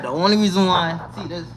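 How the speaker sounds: ordinary delivery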